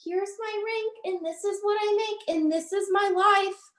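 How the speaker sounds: high mocking singsong; mocking show-off. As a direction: sing it like a bragging influencer. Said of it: "when you try to".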